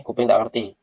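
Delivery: ordinary talk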